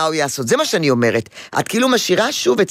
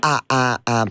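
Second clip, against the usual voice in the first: usual speaking voice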